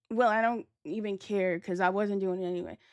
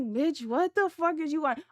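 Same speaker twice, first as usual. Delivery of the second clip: higher, exasperated outburst; 7 semitones higher than usual. Retold or imitated. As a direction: throw it at her like she's being ridiculous. Snapping at friend at bar.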